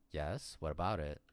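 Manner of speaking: ordinary talk, nothing marked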